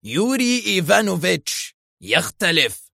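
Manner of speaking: high, strained outburst; angry. A narrator's reading. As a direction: declare it with wounded fury. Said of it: "صاح بها في غضب".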